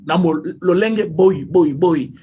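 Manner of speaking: ordinary talk, nothing marked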